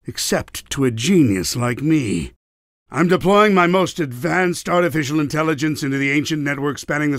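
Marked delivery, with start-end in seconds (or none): none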